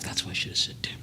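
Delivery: breathy self-scolding whisper under the breath; whispered aside. Real, not acted. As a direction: whisper it to yourself, annoyed at the slip. Muttered aside to himself.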